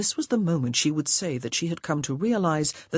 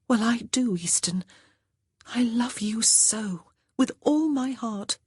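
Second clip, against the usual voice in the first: tender confession; 7 semitones higher than usual. Acted. Honor confesses her love to George, tender whisper.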